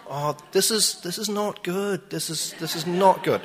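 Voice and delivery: worried. Retold or imitated. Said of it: "and I'm like".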